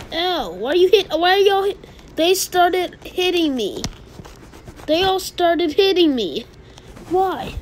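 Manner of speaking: high, loud, upset protest at being hit; high-pitched whiny protest; upset game yelps. Real, not acted. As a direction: squeal in outrage as they gang up on you.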